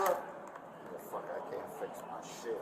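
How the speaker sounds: whispered curse under breath; whispering. Real, not acted. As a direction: mutter it voiceless, fast. Muttering after being stopped.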